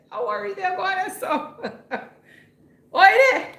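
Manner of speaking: high-pitched, laughing call. Real, not acted. Streamer laughing as Zion gets in the way, high amused shout. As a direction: call out through real laughter.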